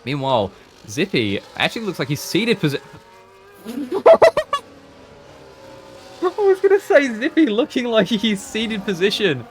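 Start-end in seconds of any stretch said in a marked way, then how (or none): 3.7-4.6 sudden squeaky laugh; high burst of laughter. Real, not acted. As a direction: laugh out at the mistake. Laughing at own slip.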